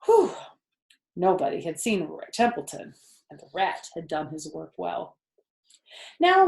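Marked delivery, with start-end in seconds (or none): 0.0-0.4 loud, high sigh of relief; relieved whew. Acted. Zuckerman sighing with relief over his pig. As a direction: let the relief burst out. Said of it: "He sighed with relief".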